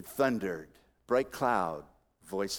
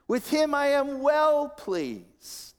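very high, slow, stretched proclamation; commendation; 5 dB louder, much slower than usual. Retold or imitated. Preacher voicing God commending his Son, high and drawn out. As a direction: draw out the praise, high then falling. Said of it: "A note of commendation".

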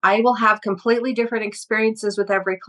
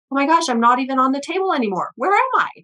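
higher, fast and rising to a squeak; startled panic; 5 semitones higher, faster than usual. Retold or imitated. Client realising she has left her body, startled and rising. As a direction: suddenly realise you are lost.

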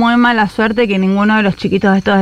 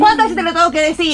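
higher, snapping at someone off the phone; exasperated scolding; 7 semitones higher than usual. Acted. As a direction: scold a helper who won't listen. Alicia yells at someone offscreen.

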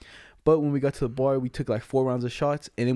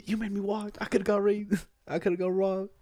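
mock whiny laughing; 6 semitones higher, 4 dB quieter than usual.

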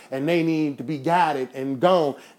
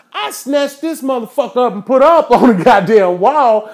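much higher, louder, shouting it out; yelling at full force while telling it; furious outburst; 7 semitones higher, 7 dB louder than usual. Real, not acted. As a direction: storm through it in a rage. Furious retelling of the slap.

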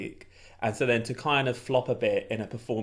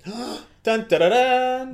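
mock fanfare; 10 semitones higher, 5 dB louder, much slower than usual. Fanfare before an impression.

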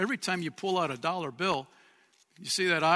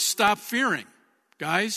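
ordinary delivery

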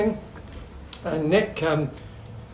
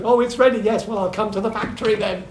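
lightly voiced supplier, pitched higher; supplier's reply; 6 semitones higher than usual. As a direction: mimic a supplier answering on the phone, higher voice. Mimics suppliers answering the phone.

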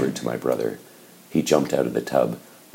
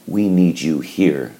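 pleading; 5 semitones higher, 7 dB louder, slower than usual. A narrator's reading. Mother begging.

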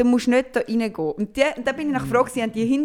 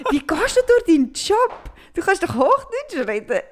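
high, slow baffled friend; mock surprised colleague; 8 semitones higher, 3 dB louder, slower than usual. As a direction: imitate the colleague's amazement, high and drawn out. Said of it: "Und sie so". (verwunderte Kollegin, hoch und langsam nachgemacht)

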